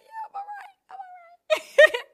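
high, wobbly "I'm all right" through mock tears; mock teary reassurance. Answering the Gojek driver through mock tears, high-pitched and wobbly. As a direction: insist she's fine while half-crying.

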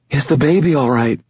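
high, pleading question; anxious. A narrator's reading. Injured pregnant girl asks after baby, anxious. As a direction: ask in a high, worried girl's voice.

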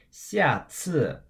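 下次 with two falling tones, slightly high start; careful teacher's 下次, both syllables falling; double fourth tone. Shown as a demonstration. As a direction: drop sharply on each syllable of 下次. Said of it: "X A I in the fourth tone".

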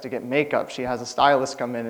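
ordinary talk

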